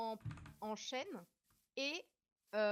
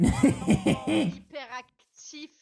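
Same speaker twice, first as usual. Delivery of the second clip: laughing; 12 dB louder, much slower than usual. Real, not acted. Laughing at friend's silly voice.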